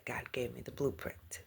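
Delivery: soft confiding whisper. Real, not acted. Telling viewers her calling, whispered. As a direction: let them in on a secret.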